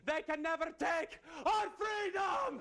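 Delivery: high heroic shout; rallying cry. Acted. Scottish warrior defies the network, rallying shout. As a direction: build to a stretched battle cry. Climax of mock battle speech.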